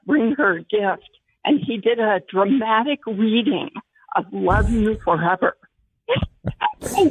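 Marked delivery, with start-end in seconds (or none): 6.1-6.9 high giggle. Real, not acted. Caller laughing at her story.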